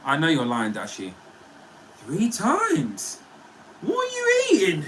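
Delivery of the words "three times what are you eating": high-pitched squeal of disbelief; incredulous. Real, not acted. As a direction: shriek it in amused disbelief. Reacting in disbelief to chat answer.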